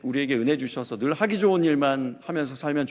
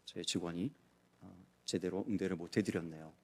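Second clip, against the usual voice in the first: soft, lower and quieter courteous apology; humbly smooth it over with the client; gently deferential sorry; polite apology; 7 semitones lower, 13 dB quieter than usual. Retold or imitated. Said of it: "정중하게".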